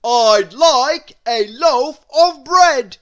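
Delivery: loud, high, slow bellow; booming shout. A narrator's reading. Mister Noisy bellowing at the baker, loud and slow. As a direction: boom it across the shop. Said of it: "Mister Noisy shouted".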